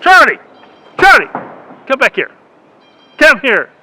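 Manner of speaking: loud call across the yard. Real, not acted. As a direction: shout for the dog to come. Calling a dog back.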